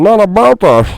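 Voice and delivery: loud, high put-on character voice; exaggerated voice-over impression; movie voice acting. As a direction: burst into a hammed-up film character. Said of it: "You see, I'm already doing it".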